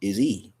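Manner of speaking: E said as the letter name, ee; long vowel E, ee. Shown as a demonstration. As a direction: say E like the alphabet letter. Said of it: "The long sound for E".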